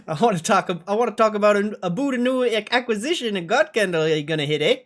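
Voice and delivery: high, loud attempt at a Canadian accent; clumsy mock Canadian accent; bad Canadian accent. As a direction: ham up a Canadian executive announcing a deal. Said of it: "I can't do a Canadian accent".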